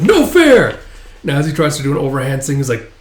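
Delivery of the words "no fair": very high, loud protest; acted outraged yell. Onlooker sees dirt thrown at their leader, outraged yell. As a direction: cry foul at full voice. Watcher protests dirty trick.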